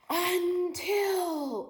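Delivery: high, loud, drawn-out until; a dramatic storyteller's until; suspenseful until. Acted. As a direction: stretch until to build suspense. Suspense in read-aloud.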